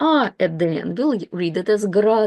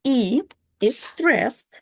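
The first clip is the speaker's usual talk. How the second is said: ordinary delivery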